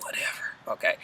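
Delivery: whispered eye-roll whatever; dismissive. Retold or imitated. Becky brushing off Lucius, whispered. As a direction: brush it off under your breath.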